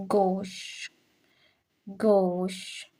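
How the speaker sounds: "Gauche" as one syllable, gohsh; teacher modelling "Gauche" with its e silent; silent final e. Shown as a demonstration. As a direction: end "Gauche" on a long sh, no vowel after. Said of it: "the E is silent".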